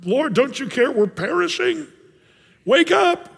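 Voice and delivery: high, rising desperate cry; mock frantic disciples shouting; panicked plea. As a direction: beg him to wake, terrified. Disciples wake Jesus in panic.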